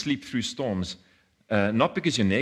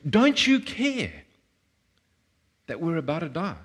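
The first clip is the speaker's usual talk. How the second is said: anguished question; 7 semitones higher than usual. Retold or imitated. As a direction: stretch out a desperate plea. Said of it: "here's the question they ask him".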